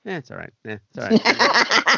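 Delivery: unimpressed. Retold or imitated. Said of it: "my mind always goes".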